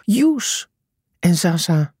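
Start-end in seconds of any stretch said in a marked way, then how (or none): 0.0-0.7 high, mildly raised call; restrained shout with a rising end; narrated shout. A narrator's reading. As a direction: call out the name, pitched high. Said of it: "Walter schreeuwde".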